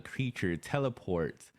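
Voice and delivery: ordinary talk, nothing marked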